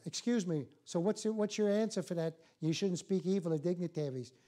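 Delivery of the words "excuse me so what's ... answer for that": ordinary talk, nothing marked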